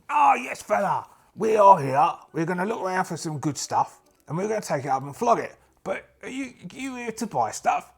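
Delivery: high, chummy geezer voice; matey adventurer. Acted. Adventurer telling merchant they'll flog stuff, high matey voice.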